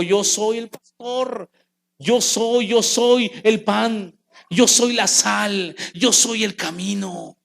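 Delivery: slow, weighty proclamation; Jesus declaring who he is, slowly and solemnly; solemn declaration. Acted. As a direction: lay down each word slowly.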